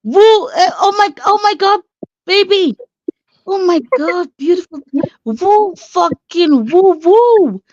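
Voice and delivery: high excited whooping; excited cheering. Real, not acted.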